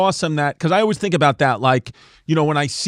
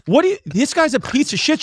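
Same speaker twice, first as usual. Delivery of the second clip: high mock girlfriend; 10 semitones higher than usual. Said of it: "She's like".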